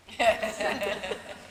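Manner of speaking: high burst of real laughter. Real, not acted. Laughs at punchline.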